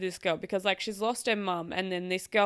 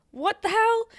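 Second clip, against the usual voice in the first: much higher, loud what the hell; outraged shock; 13 semitones higher, 5 dB louder than usual. Real, not acted. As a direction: shriek it in disbelief. Outraged reading reaction.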